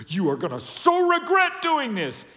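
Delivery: over-the-top acted revenge vow; mock vengeful threat. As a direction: threaten payback, hammed up. Acts the vengeful response Jesus didn't give.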